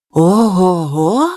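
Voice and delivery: slow, low drawn-out wonder; amazed oh-ho. A narrator's reading. Kapule marvels at the hot stars, slow low wonder. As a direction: marvel at the thought, stretching it out. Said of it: "уявив Капуле".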